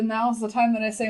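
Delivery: usual speaking voice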